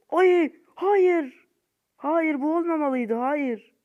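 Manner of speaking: high anguished wail of no; despairing cry. Real, not acted. Gamer howling at his missed free kick, anguished. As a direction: cry out as the shot fails.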